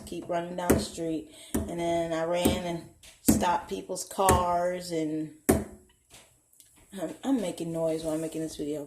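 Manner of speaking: ordinary talk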